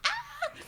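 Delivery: high, quiet mock cry; imitated baby scream. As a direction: squeal like a startled baby. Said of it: "He's like".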